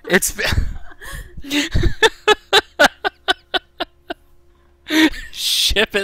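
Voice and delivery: words broken by high giggles; can barely talk for laughing; laughing hard. Real, not acted. Breaking up laughing at bug.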